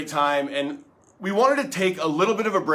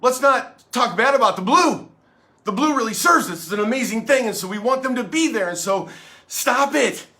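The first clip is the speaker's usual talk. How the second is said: higher-pitched, louder earnest defender; mock police supporter; 5 semitones higher, 4 dB louder than usual. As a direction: plead for the police in a raised, imitating voice. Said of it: "Then there were people out there going".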